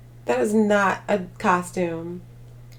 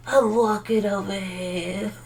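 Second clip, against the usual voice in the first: mob voice; slower than usual. Pretend. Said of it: "My mob voice".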